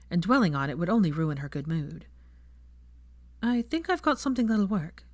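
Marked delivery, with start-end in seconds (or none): 3.4-5.1 higher, falsely breezy line; mildly put-on casual tone; forced airiness. A narrator's reading. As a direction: cover the hurt with lightness. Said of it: "forced airiness".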